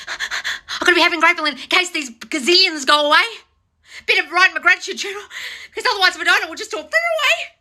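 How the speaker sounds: high, hammed-up fretful voice rising to a squeak; mock anxious billionaire. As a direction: panicky rich man afraid to lose it all, played for laughs. Mocking Bezos's anxious gratitude.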